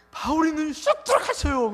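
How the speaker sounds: the evangelist tells the pastor, thrilled and loud; excited report. Retold or imitated. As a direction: blurt out the miracle news.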